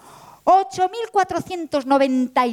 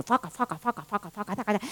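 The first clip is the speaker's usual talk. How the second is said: mimicking the crochet needles; 5 semitones lower, 10 dB quieter than usual. A narrator's reading. Portera crocheting and channel-surfing.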